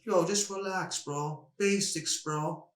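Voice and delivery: softly voiced cornerman; calming corner advice. Corner advice between rounds, acted.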